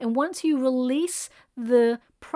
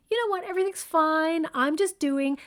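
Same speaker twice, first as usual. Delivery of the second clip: a friend brushing it off, pitched up and bright; mock cheerful friend; 6 semitones higher than usual. Friend hiding their struggle, in a mock cheerful higher voice. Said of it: "They tend to be like".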